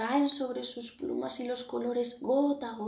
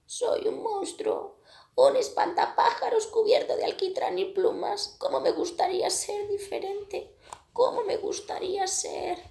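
sad crow lament; 11 semitones higher, 4 dB louder than usual. A narrator's reading. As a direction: voice the crow wishing to be different.

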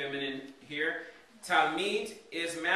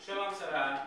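normal talking voice

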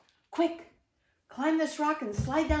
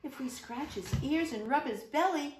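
usual speaking voice

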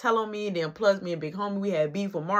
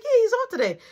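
much higher, stunned answer; incredulous; 13 semitones higher, 3 dB louder than usual. Retold or imitated. The narrator telling baby mama Gerard is off, pitched way up in disbelief. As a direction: sound thrown by the question, voice up high.

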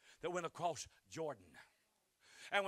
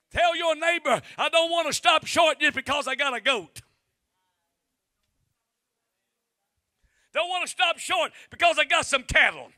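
loud, much higher, ringing preacher cadence; full-force preaching; 9 semitones higher, 16 dB louder than usual. Acted. Preacher exhorting the congregation, loud and high. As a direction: stir up the whole room.

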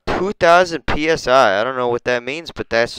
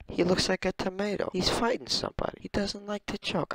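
very soft, high-pitched voice; hushed amazement; 5 semitones higher, 12 dB quieter than usual. Real, not acted. Showing a penguin lookalike, hushed high amazement. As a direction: marvel in a tiny hushed voice.

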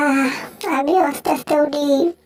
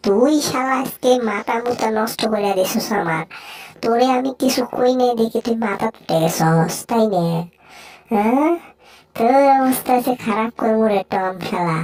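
lower-pitched character scolding; threatening cartoon bully; 4 semitones lower than usual. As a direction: taunt and threaten a beaten rival in a low voice.

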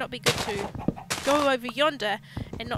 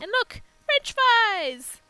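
high, loud delighted squeal; thrilled at finding fries; bursting with glee over French fries; excited; 11 semitones higher, 8 dB louder, much slower than usual. Real, not acted. Delighted discovery of food.

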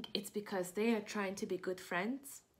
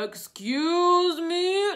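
slow, high, loud drawn-out call from above; booming whale; 7 semitones higher, 11 dB louder, much slower than usual. Acted. Reading to kids, whale calling down to Piggy and Gerald. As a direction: boom it down like a giant.

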